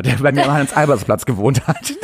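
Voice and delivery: usual speaking voice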